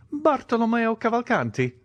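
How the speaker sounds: put-on high, delighted old major; joyful. A narrator's reading. Italian answers the Count on hearing his name, joyfully. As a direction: pitch it high with glad recognition. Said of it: "joyfully replied the Italian".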